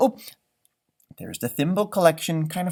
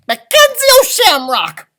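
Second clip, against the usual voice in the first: very high, very loud angry yell; 14 semitones higher, 10 dB louder than usual. A narrator's reading. Angry leprechaun yelling his name at the dog. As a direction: bellow the name in exasperation. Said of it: "I yelled".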